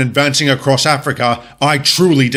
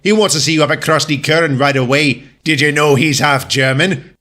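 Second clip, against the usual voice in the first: normal talking voice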